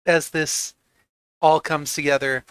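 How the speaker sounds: ordinary talk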